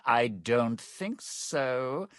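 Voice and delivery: ordinary talk, nothing marked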